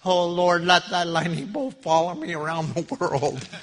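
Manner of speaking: imitated preacher's loud, high plea; Bob Hoekstra. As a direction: call up to heaven over laughter. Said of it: "everybody starts laughing and he goes".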